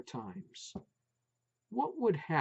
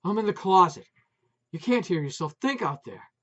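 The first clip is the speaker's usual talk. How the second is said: panting; 3 semitones higher, 7 dB louder, faster than usual. A narrator's reading. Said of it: "She panted again".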